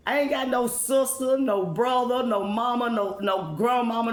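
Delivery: normal talking voice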